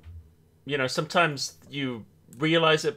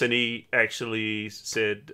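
ordinary talk, nothing marked

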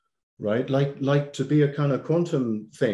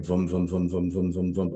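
low, monotone repeated "vum"; steady low humming throb, softly imitated; imitated body pulsing; 4 semitones lower, 4 dB quieter than usual. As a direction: pulse vum-vum-vum like a body ticking over. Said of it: "your body's just going".

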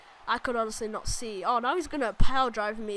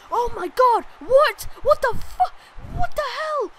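much higher, loud disbelieving shouts; shocked yelling; 10 semitones higher, 8 dB louder, slower than usual. Real, not acted. Gamer watching his finisher get countered, shocked yelling. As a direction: scream in disbelief at the screen.

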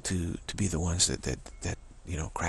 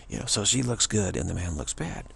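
ordinary delivery

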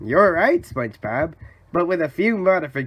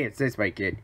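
ordinary talk

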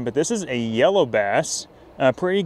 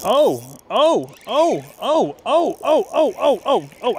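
rapid-fire high yelps of "oh"; excited exclamations; 9 semitones higher, 3 dB louder, much slower than usual. Real, not acted. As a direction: yelp with each tug of the line. Angler fighting a fish on the line.

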